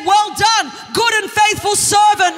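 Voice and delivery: booming a hint of Jesus welcoming; Jesus praising.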